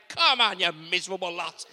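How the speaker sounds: imitating a loud rallying cry; hammed-up call to rouse the crowd; mock hype man. Preacher mimicking a worship leader firing up the church, loud mock rally. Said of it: "whipping you up into a frenzy".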